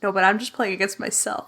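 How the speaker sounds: usual speaking voice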